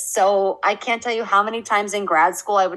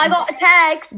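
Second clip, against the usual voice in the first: put-on high, loud British reality-show accent; the Love Island voice that got her; 8 semitones higher, 6 dB louder than usual. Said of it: "in that accent".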